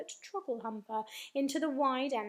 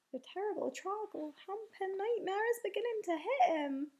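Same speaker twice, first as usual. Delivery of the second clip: higher, lilting character voice, barely hushed; whispering giant; 6 semitones higher than usual. A narrator's reading. BFG explaining the nightmare to Sophie, lightly hushed giant voice. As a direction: confide it in a giant's singsong. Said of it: "the B F G whispered".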